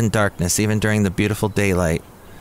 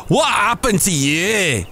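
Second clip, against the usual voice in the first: high, slow Scottish bartender; put-on Scottish voice, pitched up; Scottish accent; 8 semitones higher, slower than usual. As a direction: stretch it out in a high Scottish brogue. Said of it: "I wanna go Scottish".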